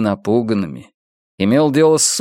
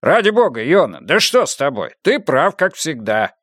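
high, swooping exasperated plea; worked-up admission, pitch leaping; exasperated concession; 3 semitones higher than usual. A narrator's reading. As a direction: give in with exasperation. Exasperated boss concedes after yelling.

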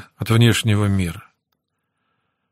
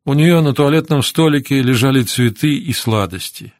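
ordinary delivery, nothing marked

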